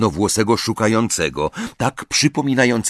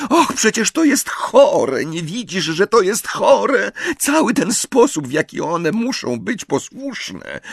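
put-on upset girl; 10 semitones higher than usual. A narrator's reading. Hermiona upset about elves.

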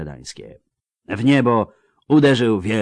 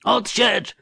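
very high, strained cry; yell; 13 semitones higher than usual. A narrator's reading. Old soldier seeing the relief army arrive, yelling. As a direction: yell it out over the battle. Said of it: "wrzasnął de Ruyter".

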